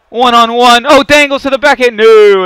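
rising hype into a loud no; excited shout. Real, not acted. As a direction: call the breakaway, then groan it out loud. Breakaway in hockey game.

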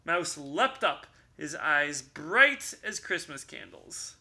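ordinary delivery, nothing marked

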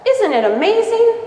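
high, delighted bug voice rising; excited beetle. Acted. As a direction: show off your art with glee. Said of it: "exclaimed the beetle".

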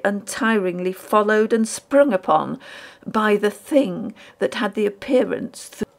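usual speaking voice

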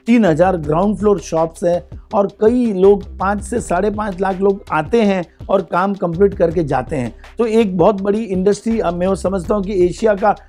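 ordinary talk

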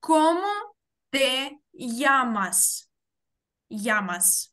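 slow teacher's model, llamas said as "yamas"; Cómo te llamas in separate chunks, llamas with a y; double L as Y. Shown as a demonstration. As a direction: spell it out slowly, ll in llamas as a y. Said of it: "яке ми читаємо як".

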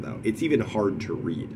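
ordinary talk

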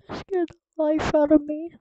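high, very loud scared shout; jump scare shout. Real, not acted. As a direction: scream it after a jump scare.

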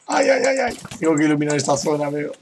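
ordinary delivery

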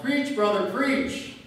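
loud, high call of encouragement; deacon's yell. Retold or imitated. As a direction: shout him on from the pews. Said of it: "One of the deacons yelled".

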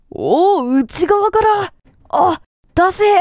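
high, loud, stretched shout of realization; shocked shout. Real, not acted. Streamer realizes the handprints meant let me out, shocked shout. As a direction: yell it out as the horror dawns.

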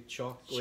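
"chocolate" said the Chicago way; Chicago pronunciation. Shown as a demonstration. Reading how Chicago says it, Chicago pronunciation. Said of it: "Here in Chicago, we say".

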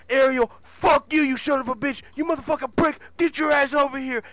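high, loud enraged cursing; acted furious shout. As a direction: bellow like an enraged boss going after his heckler. Acted furious quarrel after faceplant.

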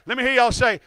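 ordinary talk

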